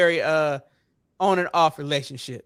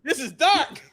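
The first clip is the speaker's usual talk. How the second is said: laughing quote; 6 semitones higher, 4 dB louder, slower than usual. Retold or imitated. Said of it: "which I was like".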